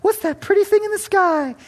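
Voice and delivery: put-on high, wondering child voice; imitating a child. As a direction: ask it like a little kid in awe. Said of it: "introduce your child to Jesus".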